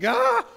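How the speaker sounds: sudden high pained yelp; a short sharp scream of pain; cry of pain. Retold or imitated. Demon crying out in pain as Jesus arrives, loud pained yelp. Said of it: "this is like a cry of pain".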